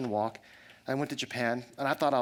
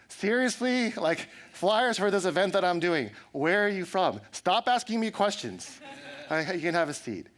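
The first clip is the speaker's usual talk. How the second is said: mock exasperation; 6 semitones higher than usual. Annoyed replies to questioning guy.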